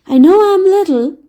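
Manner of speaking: put-on hurt boy. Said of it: "feeling rather hurt".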